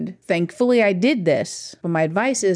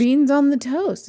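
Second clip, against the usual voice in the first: Beans on the toast in a slow, higher British accent; 7 semitones higher than usual. Shown as a demonstration. Host corrects partner, shows British phrasing. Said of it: "you say it in a British accent".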